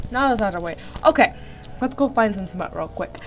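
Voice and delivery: usual speaking voice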